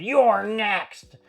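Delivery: high, loud retold shout of a threat; imitating a yell. Goldberg in the ring, threatening the champion: loud yell. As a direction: bark it out like a challenger. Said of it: "yelled and screamed and said".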